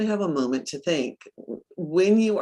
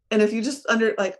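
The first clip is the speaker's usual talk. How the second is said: ordinary delivery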